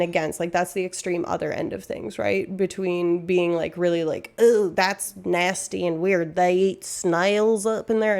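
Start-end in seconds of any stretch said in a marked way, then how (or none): 4.4-8.2 slow put-on American accent; mock American accent. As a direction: lightly imitate an American sneering at foreign food. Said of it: "I'm doing an American accent".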